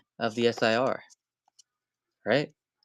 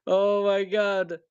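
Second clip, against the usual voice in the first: excited gasp; 7 semitones higher, 3 dB louder, slower than usual. Real, not acted. High excited reaction.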